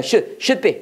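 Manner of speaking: should be를 '슛삐'로 짧게; should의 d를 막고 바로 be로 연결; 받침으로 발음. Shown as a demonstration. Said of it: "다 받침을 읽어야 돼". (should be, 모음 덧붙임 없이 '슛-삐')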